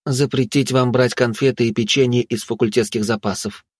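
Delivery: ordinary delivery, nothing marked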